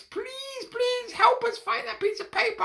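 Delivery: very high begging voice; pleading cats. Acted. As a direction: cats begging the mice for help. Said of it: "they said to the mice".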